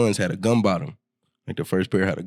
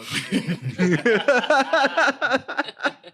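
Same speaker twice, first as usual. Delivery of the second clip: big laugh roar. Real, not acted. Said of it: "Do the roar".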